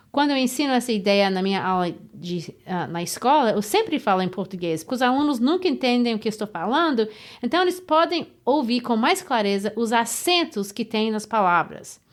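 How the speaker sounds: quick Portuguese, each stressed syllable clearly marked; fluent fast Portuguese demo of word stress; speaking Portuguese. Shown as a demonstration. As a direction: rattle it off in Portuguese so the beat shows. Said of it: "let me illustrate by speaking in Portuguese".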